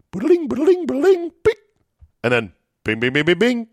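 playful imitated slot sounds; slot machine jingle. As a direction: mimic the ringing slot machine.

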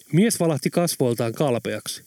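plain reading voice

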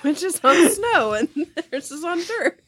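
high, giggly voice; laughing while talking. Real, not acted. Contestant whose answer was stolen, really laughing as she talks. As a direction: talk through real laughter.

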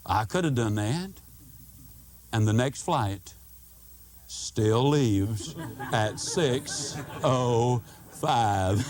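slow, low, deadpan delivery; deadpan punchline. Acted. Telling the audience the next flight still leaves, low and deadpan. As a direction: let the punchline land slowly.

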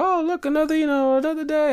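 high mock sing-song voice; mocking other people. Mocking people's dread of the day, in a high mocking voice. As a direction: lampoon the gloomy crowd way up high. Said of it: "People are like".

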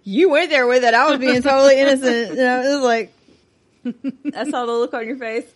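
fast mock defensive protest; mock indignant. Real, not acted.

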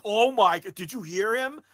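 high, loud gushing; mock excited fans. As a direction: gasp it out to your buddy in awe. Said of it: "turning to each other like".

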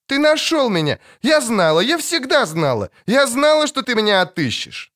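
put-on high, breathless child's voice full of joy; a small girl clinging to him, overjoyed; joyful little girl. A narrator's reading. Girl reunited with witcher.

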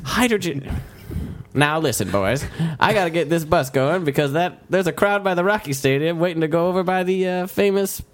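put-on high old-timey shuttle driver. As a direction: round up the riders in a quaint driver's voice. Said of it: "old-timey shuttle voice".

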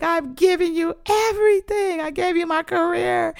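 very high, anguished plea; retold tearful crying out. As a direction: plead with God in a high, crying voice. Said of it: "And I'm just crying".

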